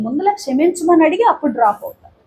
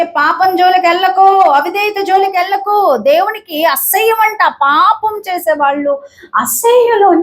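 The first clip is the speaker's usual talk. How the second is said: higher, rising preacher's voice; fervent preaching; 6 semitones higher than usual. Acted. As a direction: preach it with mounting fervour. Preaching warning, raised.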